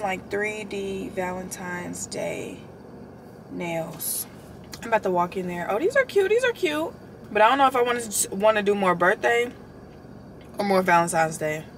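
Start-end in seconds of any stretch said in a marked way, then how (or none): 5.7-6.9 excited delight. Real, not acted.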